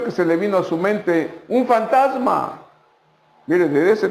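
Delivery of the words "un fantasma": frightened cry. Acted. Disciples' fright at the ghost.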